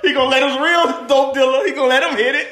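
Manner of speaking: ordinary delivery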